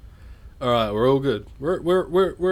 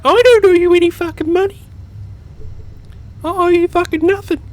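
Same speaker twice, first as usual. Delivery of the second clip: much higher, much louder outraged shriek; mock furious yell; 6 dB louder than usual. Offended viewer yelling back at the vlogger, shrill mock rage. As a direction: scream it back like a furious stranger. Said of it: "they're gonna be like".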